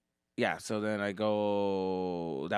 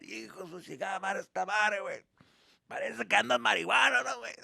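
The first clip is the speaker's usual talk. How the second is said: grumbling dad; 8 semitones higher than usual. Retold or imitated. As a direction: curse the ride under your breath. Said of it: "And then my dad".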